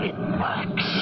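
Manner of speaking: breathless whisper of disbelief; awed whisper. Acted. Inventor hears his wind-powered car start, awed whisper. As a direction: whisper it as if you can't believe your ears.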